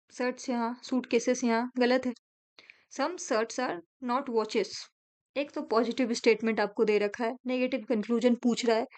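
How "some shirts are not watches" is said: each word drawn out slowly; slow teacher dictation of a statement. Shown as a demonstration. Reads conclusion aloud.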